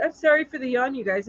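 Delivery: usual speaking voice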